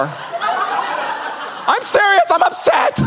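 high, strained mock yell; mock upset protest. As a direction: whine it like a sulking husband. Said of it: "that would tick me off even more".

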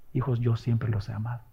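soft, low loving declaration; tender God. Acted. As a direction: say it like God embracing his children. (Dios habla a su pueblo, con ternura y voz baja)

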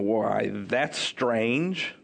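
slow, low mock puzzlement; teasing. Healed man to his questioners, gently teasing. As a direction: draw it out as a dry tease. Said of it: "he's still kind of teasing".

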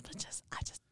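hushed under the breath; whispering so work won't hear. Real, not acted. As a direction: drop to a secret whisper. Doesn't want work to hear.

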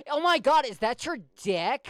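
bad acting gasp. Mocking bad porn acting, a hammed-up gasp. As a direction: overact the fake surprise like bad porn. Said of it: "What kind of horrible acting?".

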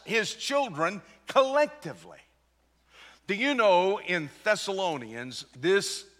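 high, driving pulpit voice with punched key words; forceful preaching. Real, not acted. Preacher tells church he is coming for you, forceful preaching.

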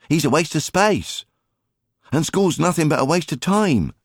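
put-on high, eager child's voice begging; pleading boy. Alfie begging his mother to let him go fishing, pleading child voice. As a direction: wheedle like a boy desperate to skip school.

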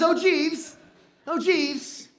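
lightly British king calling his servant, pitched high; mock British accent. King summoning his servant Jeeves, mock British. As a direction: call out in a playful British accent. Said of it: "I don't know why he was British".

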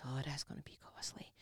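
whispered, fretting over the cost; whispered worry. Retold or imitated. Preacher voicing a car owner's fears, whispered. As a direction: breathe it out like a worried owner. Worried driver muttering.

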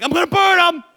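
loud, high mock fury; mock yell at a nagging child; dad pretending to threaten burning the toys; hammed-up angry parent. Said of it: "I'm like".

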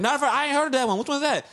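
a light take on the confused barber; imitating the barber. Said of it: "He's like".

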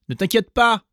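put-on high, loud children's voices; eager kids promising to be careful; little goats. A narrator's reading. Young goats reassuring their mother, high and eager.

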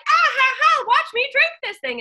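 very high, loud fake laugh; fake cheerful laugh. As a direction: laugh it off like nothing happened. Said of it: "and Ellen's like".

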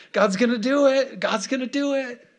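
high, giddy repeated cheer; acted excitement. Preacher acting out believers expecting God to act, excited. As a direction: squeal it with hammed-up glee. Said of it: "Moses is so excited".

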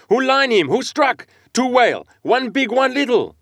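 high, loud excited harpooner's cries; excited shouting. A narrator's reading. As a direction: shout each question to the boat. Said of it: "cried Queequeg".

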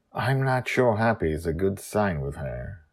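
ordinary delivery

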